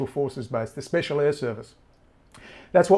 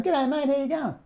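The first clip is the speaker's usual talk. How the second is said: much higher, breezy Aussie mate greeting; mock the friendly agent at the door; cheery cop greeting; 12 semitones higher than usual. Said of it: "with their cards going".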